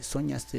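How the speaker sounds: "Soñaste" with SO high, then falling; misplaced first-syllable stress. Shown as a demonstration. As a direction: test a wrong early stress, SÓñaste. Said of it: "¿cuál es la sílaba tónica de la palabra soñaste?".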